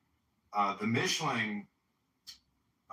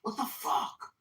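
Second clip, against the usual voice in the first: retold outburst; 13 semitones higher, slower than usual. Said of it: "And he said".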